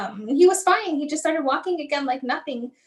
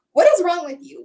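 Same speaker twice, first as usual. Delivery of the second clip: mildly indignant outburst, higher and louder; retold anger; 4 semitones higher, 5 dB louder than usual. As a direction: snap back in offended disbelief, re-enacted. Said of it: "I was angry".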